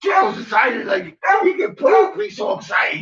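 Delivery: high, excited pet talk; high-pitched fussing over the dog. Real, not acted. Excitedly talking about the barking dog.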